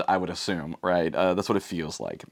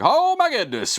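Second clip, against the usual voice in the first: amazed crowd; 4 dB louder than usual. Retold or imitated.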